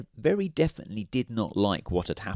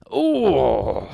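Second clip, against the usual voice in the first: a higher, louder startled squeak; alarmed squeak; 3 semitones higher, 5 dB louder than usual. A narrator's reading. Mister Grumpy tickled in the butcher's shop, startled squeak. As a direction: squeak as if something just grabbed you. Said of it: "squeaked Mister Grumpy in alarm".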